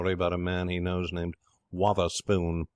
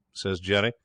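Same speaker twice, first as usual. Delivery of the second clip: usual speaking voice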